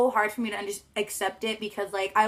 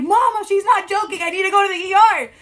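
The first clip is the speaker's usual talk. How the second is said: pleading yell; 11 semitones higher, 11 dB louder than usual. Retold or imitated. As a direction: shout at mom to believe him. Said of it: "I'm like".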